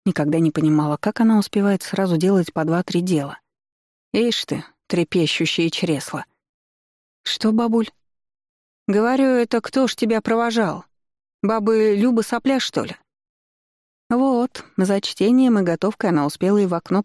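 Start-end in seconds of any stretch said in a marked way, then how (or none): 4.1-13.0 put-on old grandmother. A narrator's reading. Grandmother voiced by narrator.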